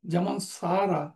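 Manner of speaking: ordinary talk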